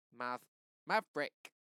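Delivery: Maverick broken into stressed syllables; slow, loud, warning Maverick; name split sharply. A narrator's reading. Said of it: "breaks his name down real good".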